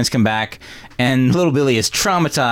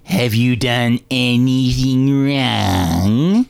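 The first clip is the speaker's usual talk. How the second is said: put-on very slow, drawn-out sinister old man; evil grandpa; much slower than usual. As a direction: stretch each word to scare the child. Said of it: "just the evilest grandpa ever".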